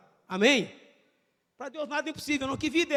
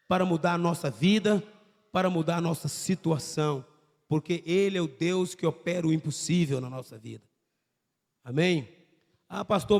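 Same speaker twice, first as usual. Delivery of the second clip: lower, calm pastoral appeal; prayer-time preaching; 7 semitones lower than usual. Acted. Pastor calling the congregation to close their eyes, calm and low.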